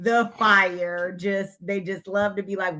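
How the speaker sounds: ordinary delivery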